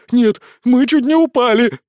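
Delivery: high, loud howl; panicked wail. A narrator's reading. Boy wizard protests after near fall, panicked wail. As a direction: wail it out, still terrified. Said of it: "взвыл мальчик-волшебница-фея".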